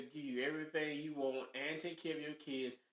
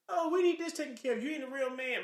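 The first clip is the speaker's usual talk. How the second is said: high scornful woman's voice; mocking the girlfriend; 8 semitones higher, 4 dB louder than usual. Man mimicking his woman calling him not a real man, high and scornful. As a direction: mimic her dismissive nagging. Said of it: "flip again to".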